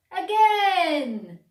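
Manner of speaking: crowd calling out. A narrator's reading. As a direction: shout for an encore. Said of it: "said the crowd".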